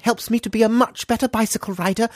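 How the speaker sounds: very high, put-on little child voice; idealised child. Imagined son telling Daddy his wish, high earnest child voice. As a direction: pipe up like an earnest small child. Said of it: "hope that maybe your children will wish for".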